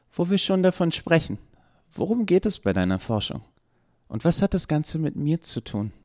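curious Eva. A narrator's reading. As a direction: play Eva curious and suspicious.

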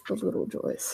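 very soft, whispered repeat; hushed echo. Real, not acted. Teacher savouring little pleasures, whispered aside. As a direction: let it fall to a whisper. Same phrase repeated under breath.

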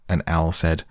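usual speaking voice

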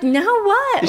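high, innocent and clueless; oblivious. Retold or imitated. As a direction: play dumb brightly. Friend voiced oblivious next morning.

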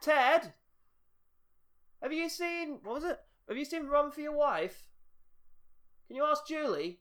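high-pitched call to someone off in the distance. Real, not acted. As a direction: call through to another room. Calling someone away from the mic.

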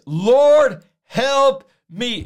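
three words thrown out as a loud plea; short urgent cry. Acted. Preacher voicing the woman's three-word prayer, short and loud. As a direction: LORD cried out loud, then help me quick and direct. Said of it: "She, three words".

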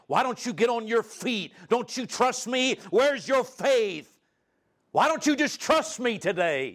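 high-pitched, imagined scolding; angry rebuke. Acted. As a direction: scold her for doubting, pitched well up.

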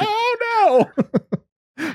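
very high comic wail; hammed-up dismayed cry; mock alarm. Ed stuck after stabbing the tire, mock-alarmed.

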